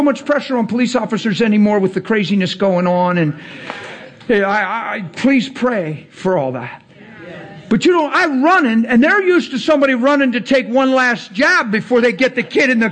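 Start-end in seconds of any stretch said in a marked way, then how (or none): none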